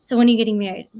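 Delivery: lightly voiced dad, pushing out the question loudly; dad's pointed question. Dad to graduating child about marriage, pointed and loud. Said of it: "my dad was like".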